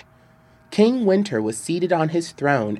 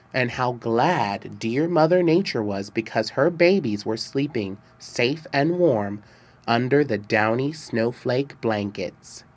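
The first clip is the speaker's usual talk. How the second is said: usual speaking voice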